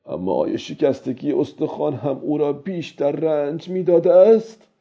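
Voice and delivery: lightly voiced old man reasoning with himself; a hint of high character voice in self-talk; old fisherman answering himself. A narrator's reading.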